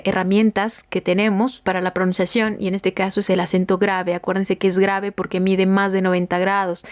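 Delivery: usual speaking voice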